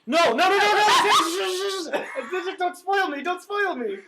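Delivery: high, loud squealing no no no; panicked protest. Real, not acted. Host begging co-host not to spoil the book, high-pitched shriek.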